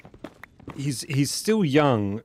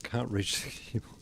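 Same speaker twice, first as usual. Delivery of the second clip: ordinary talk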